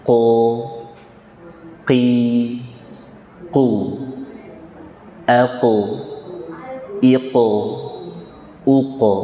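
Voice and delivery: "Ko" from the back of the tongue, not throat; qaf from tongue root. Shown as a demonstration. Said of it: "simak dulu bunyinya". (qaf dari pangkal lidah)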